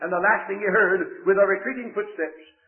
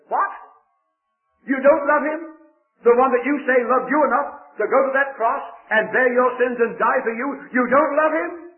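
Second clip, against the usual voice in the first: higher and louder, indignant disbelief; indignant outburst; 5 semitones higher, 5 dB louder than usual. Acted. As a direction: burst out in shocked indignation. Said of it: "her indignation burst forth".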